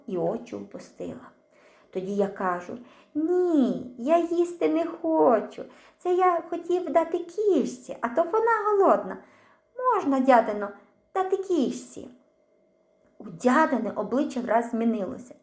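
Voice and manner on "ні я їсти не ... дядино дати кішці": put-on high, childlike and earnest; boy's voice. A narrator's reading. Small boy answering the aunt, high innocent voice. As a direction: play innocent to save face.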